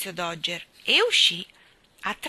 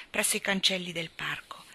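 plain reading voice

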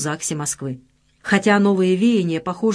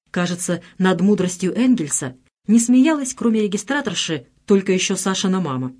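ordinary delivery

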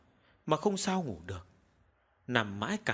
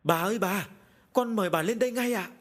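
high, raised, alarmed calling; loud urgent call; 7 semitones higher, 4 dB louder than usual. A narrator's reading. Frightened maid summoning her mistress upstairs, calling loudly. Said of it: "lớn tiếng gọi".

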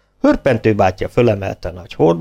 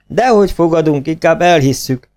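higher-pitched, hasty exclamation; crying out; 7 semitones higher, 3 dB louder than usual. A narrator's reading. Ferencváros men refusing the bet, crying out. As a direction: call it out high, backing off quickly. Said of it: "kiáltották a ferencvárosiak".

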